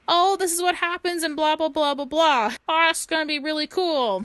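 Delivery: high-pitched, excited chattering to dad; imitated excitement, pitched well up; excited kid. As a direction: mimic an eager kid gushing about a movie. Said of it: "he was just excited about this movie".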